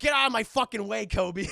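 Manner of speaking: loud hammed-up brush-off; mock angry shout. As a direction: shout it like an annoyed passerby. Voices people shouting at Tucker.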